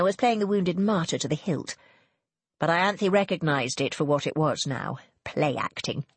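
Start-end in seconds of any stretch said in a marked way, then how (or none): none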